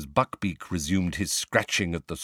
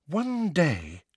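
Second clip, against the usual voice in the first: exasperated; 9 semitones higher than usual. A narrator's reading. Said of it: "sounding thoroughly exasperated".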